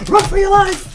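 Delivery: high, loud comic scream of panic; mock shout. Quoting the skit, a high yelled run for your life. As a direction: yell it like fleeing a monster.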